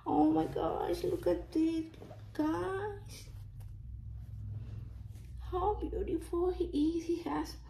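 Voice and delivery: high excited awe; high amazed gushing. Real, not acted. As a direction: gush to the viewers. Showing doll, voice raised.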